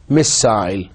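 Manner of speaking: RP "missile" ending in "-sile" like "aisle"; British RP. Shown as a demonstration. Teacher modelling RP "missile" for students. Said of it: "R P".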